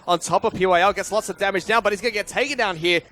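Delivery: loud, high-pitched fight call; excited esports play-by-play. Real, not acted. Caster calling kills in a team fight, excited play-by-play. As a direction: drive the action at full energy.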